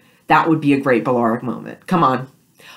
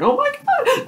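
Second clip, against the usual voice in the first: very high squeal with laughter; squealing fangirl; slower than usual. Retold or imitated. Fan imagining the kiss, squealing with laughter. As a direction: shriek with delight at the kiss. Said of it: "and it's like".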